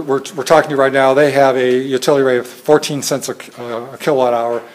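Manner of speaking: ordinary talk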